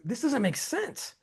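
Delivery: soft, slow puzzled murmur. Retold or imitated. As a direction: mutter it to yourself at the phone. Puzzled looking at phone.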